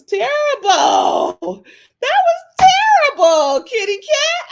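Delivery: high hammed-up wail; mock wailing. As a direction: wail it like fake crying.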